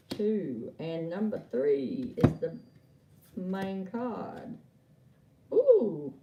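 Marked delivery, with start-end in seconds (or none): 5.5-6.1 loud, high "ooh" of surprise; impressed ooh. Real, not acted. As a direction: exclaim at a revealed card. Reacts to the card turned up.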